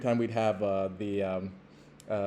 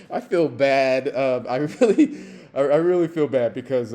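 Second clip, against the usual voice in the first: laughing through it; 2 semitones higher, 7 dB louder than usual. Real, not acted. Laughing while admitting guilt.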